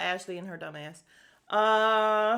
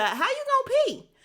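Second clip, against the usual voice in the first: high, loud mock outburst; mock-alarmed and much higher; incredulous question; 6 dB louder than usual. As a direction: blurt it out high, imitating a baffled youngster. Daughters' question flips, retold.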